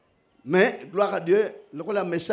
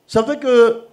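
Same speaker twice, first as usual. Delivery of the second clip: loud, fast, high preaching to the congregation; full-force preaching; 4 semitones higher, 11 dB louder than usual. Acted. Preacher addressing the brothers in Christ, loud preaching. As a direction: preach it out over the music.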